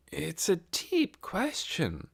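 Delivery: slow, weary adult voice; worn out at the end of the day; tired aunt. A narrator's reading. Crystal tired.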